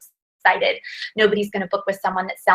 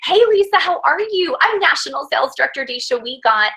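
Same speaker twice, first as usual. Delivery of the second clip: mock excited greeting; 5 semitones higher than usual. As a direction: ham up a perky phone greeting. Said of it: "I couldn't call people and go".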